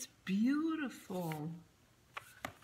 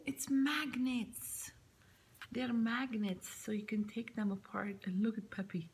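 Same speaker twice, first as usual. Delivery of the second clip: whispering; 3 semitones higher than usual. Real, not acted. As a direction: whisper each find in wonder. Hushed unboxing.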